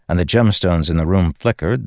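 ordinary delivery

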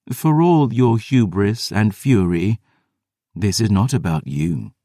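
slow, even, otherworldly lecture; measured and aloof, deliberately slow; vampire sister rebuking her brother, slow and cool; cold vampire sister. Acted. Selene speaks.

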